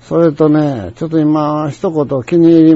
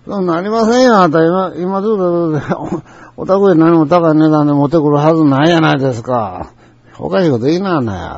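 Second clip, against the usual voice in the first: normal talking voice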